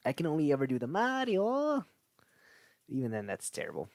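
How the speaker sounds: usual speaking voice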